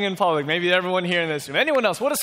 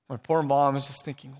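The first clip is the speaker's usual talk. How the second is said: normal talking voice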